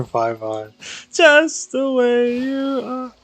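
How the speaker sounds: ordinary delivery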